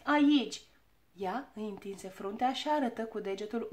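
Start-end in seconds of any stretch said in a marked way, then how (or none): 0.0-0.6 high, loud, insistent pointing-out; fretful. A narrator's reading. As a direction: press him impatiently, stretching the words. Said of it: "se frământa Ochii Albaștri". (insistent, cu glas ridicat)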